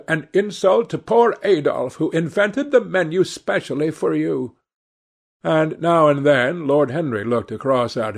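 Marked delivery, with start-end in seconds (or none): 0.1-4.5 high-pitched scolding hostess; understated high-voiced reproach; mild scolding. A narrator's reading. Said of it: "kept scolding him".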